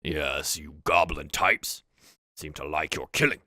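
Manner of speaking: low chuckle into measured orc speech; slightly amused orc chief, voice dropped; orc leader. Acted. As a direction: orc leader addressing an underling, unhurried. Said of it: "Valrul chuckles a little bit".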